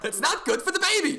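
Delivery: hammed-up high concern; mock fussy warning. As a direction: tease like a fussy aunt. Mocking a character's concern.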